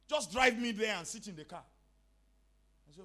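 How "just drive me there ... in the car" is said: loud, pushy demand, pitched up; insistent mother. Retold or imitated. Mother ordering her son to drive, loud and insistent. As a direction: insist like a mother who won't be refused.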